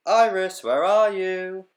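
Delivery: high loud call, slow. Acted. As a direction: call out for a lost dog. Bee calls for her dog.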